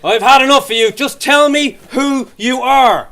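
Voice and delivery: fed up, demanding every word; mock angry cop. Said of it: "policeman losing patience".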